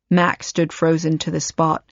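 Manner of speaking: usual speaking voice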